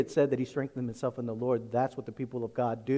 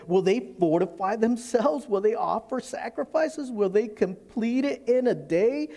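higher, scornful taunting questions; mocking enemy; 6 semitones higher than usual. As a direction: sneer each question like a mocking foe. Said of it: "He starts mocking".

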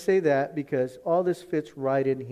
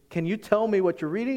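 higher, louder curious ask; man keenly asking what he reads, pitched up; eager question; 8 semitones higher, 5 dB louder than usual. Retold or imitated. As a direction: lean in keen to know. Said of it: "like when he says".

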